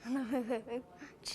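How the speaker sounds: giggling through the words, half-hearted; giggly, no conviction. Real, not acted. Boy asked to tell his parents he loves them, giggling shyly. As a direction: laughs it out instead of meaning it. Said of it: "You gotta say that with a little more, uh, conviction".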